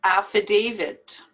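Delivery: "Affidavit" ending in a clear T, not D; final T. Shown as a demonstration. Teacher correcting the common D ending, T pronounced. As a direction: release the T at the end of "Affidavit". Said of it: "have to pronounce the T".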